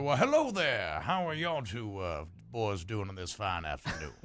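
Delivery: courteous Southern greeting; genteel Southern hello; Southern accent. Acted. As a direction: greet them with a lightly played Southern accent. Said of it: "Are we doing the accent for everybody?".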